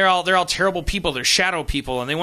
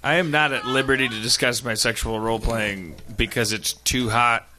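low, measured, official-sounding reply; mock-formal refusal; 7 semitones lower than usual. Guest refusing to discuss role play, low mock-formal delivery. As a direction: decline with deadpan formality.

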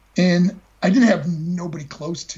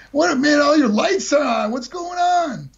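put-on drunk buddy; 9 semitones higher than usual. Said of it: "he goes".

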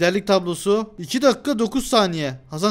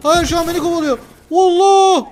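being chased; 9 semitones higher, 7 dB louder than usual. Real, not acted. Panicking while being chased.

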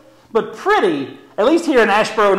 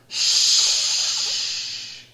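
long hushing "shh"; drawn-out classroom shush; teacher's shush; 6 dB quieter than usual. Pretend. Teacher hushing a pretend noisy class, long shush. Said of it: "I do like your teacher voice".

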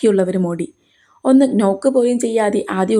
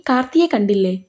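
a lightly voiced anxious older man, a bit higher; gently alarmed, asking what's wrong; worried father; 6 semitones higher than usual. Father asks his crying daughter what happened, anxiously.

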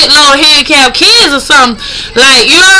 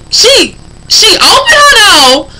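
usual speaking voice

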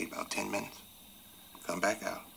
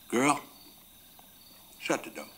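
put-on raised call on GIRL, then a firm order; first firm order; 6 semitones higher, 6 dB louder than usual. Father telling his little girl to shut the door, firm. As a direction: tell the child firmly, holding back full volume. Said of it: "I said a little louder".

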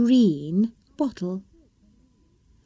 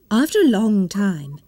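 ordinary delivery, nothing marked

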